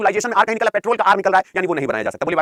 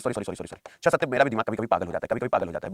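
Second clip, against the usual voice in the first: much softer, lower apologetic mumble; embarrassed mumble; 9 semitones lower, 7 dB quieter than usual. Real, not acted. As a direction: mumble sorry under your breath. Teacher embarrassed by own mistake. (अपनी गलती पर धीमे से बुदबुदाना)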